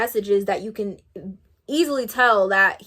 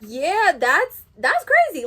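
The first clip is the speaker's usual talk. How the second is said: incredulous; 7 semitones higher, 4 dB louder, slower than usual. Real, not acted. Exasperated disbelief.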